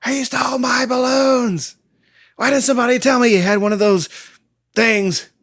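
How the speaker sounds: high, loud indignant Joker whine; Joker tantrum. Retold or imitated. Joker rages at his goons, a high loud whine. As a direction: throw a shrill villain tantrum over the balloons.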